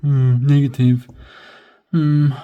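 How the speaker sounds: grumbling "Hmm"; negative. Shown as a demonstration. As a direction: sound down about it. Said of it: "if you wanna sound like".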